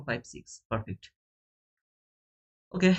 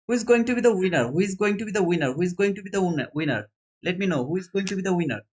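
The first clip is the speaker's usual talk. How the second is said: raised, quick hype for the winner reveal; hyped race call; 3 semitones higher, faster than usual. Real, not acted. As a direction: whip the viewers up like a race announcer. Race announcer hyping the winner.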